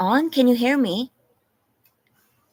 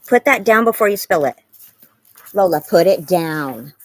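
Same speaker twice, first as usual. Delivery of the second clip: loud scolding; 9 dB louder than usual. Real, not acted. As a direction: order the dog to drop it. Scolding dog with food bowl.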